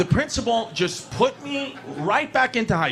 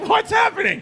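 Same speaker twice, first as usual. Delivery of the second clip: 高声反问，满是不解; baffled squeak; 13 semitones higher than usual. Retold or imitated. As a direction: voice jumps way up in mock confusion. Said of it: "I'm like".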